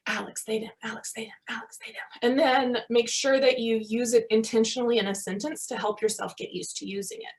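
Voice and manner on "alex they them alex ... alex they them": whispered drill of name and pronouns; practising under your breath. Shown as a demonstration. Rehearsing a friend's new pronouns alone, whispering. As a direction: murmur it to yourself on repeat. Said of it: "you sit and you go like".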